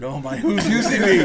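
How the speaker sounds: high, loud mock panic; loud freaking out. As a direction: burst out in hammed-up alarm. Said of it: "I was just freaking out".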